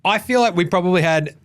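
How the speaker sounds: ordinary talk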